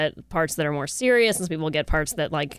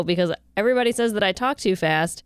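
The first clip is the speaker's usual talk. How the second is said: usual speaking voice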